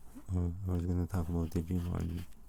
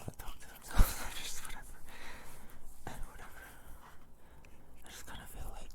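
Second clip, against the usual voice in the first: soft close-mic whisper; breathy ASMR whisper, barely voiced; tingly asmr mouth sounds; 4 dB quieter than usual. Continues whispered ASMR talk.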